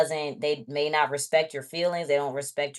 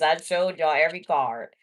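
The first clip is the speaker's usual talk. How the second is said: ordinary delivery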